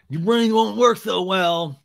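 a hint of a whiny Sandoval; mock whine. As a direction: needle him with a mock whine. Mocking Tom's complaint.

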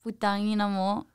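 soft, timid mumble of a swear word; hushed, reluctant delivery; near whisper. Real, not acted. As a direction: say it under your breath. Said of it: "why are you gonna whisper it?".

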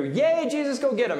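high, happy demo cheer. Shown as a demonstration. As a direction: show the children how to cheer him on. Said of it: "every time I do a happy face".

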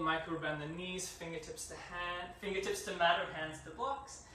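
soft, steady instructor cues over breath; yoga class cueing. Acted. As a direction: guide the class through each pose. Cues a yoga sun salutation.

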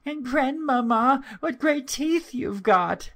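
put-on very high, childlike wonder; amazed little girl. A narrator's reading. Little girl puzzled by grandmother's looks, high childlike wonder. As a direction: marvel as a small child, voice pitched right up. The girl, rather afraid, marvels.

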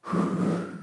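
long exhaled cigar puff. Acted. Man punctuating his line, breathy cigar exhale. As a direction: blow out smoke slowly. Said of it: "use his cigar to punctuate his sentences".

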